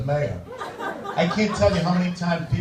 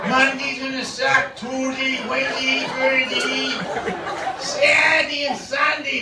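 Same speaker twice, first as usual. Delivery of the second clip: put-on grumpy Boston accent; 7 semitones higher than usual. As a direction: moan about the week like a loudmouth Bostonian. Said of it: "obviously from Boston".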